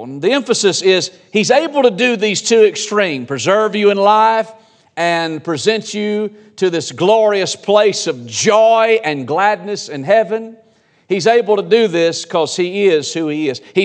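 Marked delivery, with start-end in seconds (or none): none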